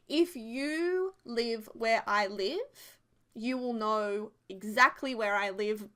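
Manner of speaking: very slow, deliberate, word by word; careful restart. Real, not acted. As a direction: spell it out slowly after a muddle. Restarts carefully after muddling it.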